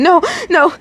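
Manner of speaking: high, crying shout; hysterical shout. Acted. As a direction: push away in panic. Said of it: "shouted out hysterically".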